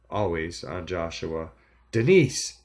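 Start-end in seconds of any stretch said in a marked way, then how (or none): 1.9-2.6 high, loud desperate yell; desperate call. A narrator's reading. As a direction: shout her back in panic. Spencer calls desperately.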